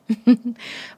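amused little laugh; playful chuckle; light chuckle. Acted.

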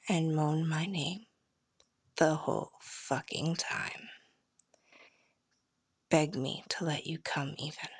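soft, breathy seductive whisper; intimate whisper. Acted. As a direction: whisper it close into the ear.

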